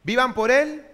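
forceful echo of the verse; loud repeated verse. Real, not acted. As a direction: answer back with the verse. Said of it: "¿Qué dice?".

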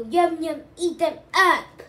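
very loud, high hungry chant; bellowing toilets. A narrator's reading. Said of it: "they below".

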